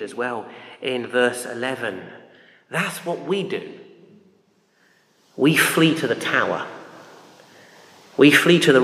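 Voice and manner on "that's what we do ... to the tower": usual speaking voice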